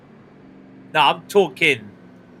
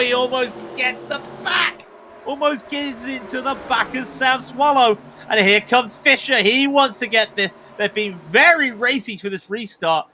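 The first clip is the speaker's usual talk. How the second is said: excited play-by-play; 8 semitones higher than usual. Acted. Commentator calling the restart to listeners, excited race call. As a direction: call the restart like it's live on air.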